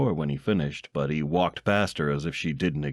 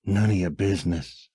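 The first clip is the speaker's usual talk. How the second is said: mumbled. A narrator's reading. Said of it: "he mumbled".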